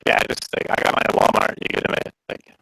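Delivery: glitchy robotic call audio; laggy, robotic internet voice; half robot voice. Real, not acted. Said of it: "You went robot-y".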